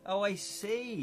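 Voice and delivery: normal talking voice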